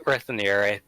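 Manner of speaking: normal talking voice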